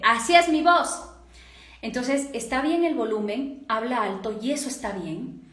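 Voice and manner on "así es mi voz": acted loud self-defence; loud protest. Person accused of shouting insists they aren't, loud protest. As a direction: insist in a raised, higher voice. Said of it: "No, no te estoy gritando".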